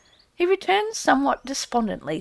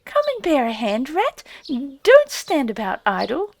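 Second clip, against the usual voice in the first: high, louder mouse voices urging; field mice squeak; 5 semitones higher, 6 dB louder than usual. A narrator's reading. Mice urging idle Rat to help, high squeaky voices. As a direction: chivvy him like busy little mice.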